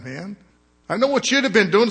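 usual speaking voice

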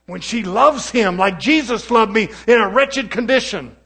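loud, high, fiery preaching; rising gospel fervour on one phrase; preaching at full force. Acted. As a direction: lift it into a full-voiced sermon cry. Preacher builds to a peak.

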